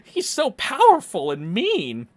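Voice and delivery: slow, high, loud mimicry of complainers; hammed-up "poor me" whine; mock awe. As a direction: drag it out in pretend dread. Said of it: "They're like".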